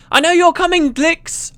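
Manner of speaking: high, loud taunting shout. Acted. Engineer calling out to her pursuer over the din, shouting. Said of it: "a voice shouted from up ahead".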